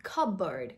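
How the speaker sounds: Cupboard as "CUB-erd"; no P sound. Shown as a demonstration. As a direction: say Cupboard without P, falling. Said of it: "No P sound".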